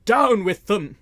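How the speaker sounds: loud, high furious bellow; angry bull bellow. A narrator's reading. Sheriff bellows at his men to attack, furious roar. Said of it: "in a voice like an angry bull".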